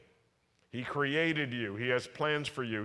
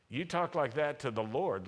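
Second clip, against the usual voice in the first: ordinary talk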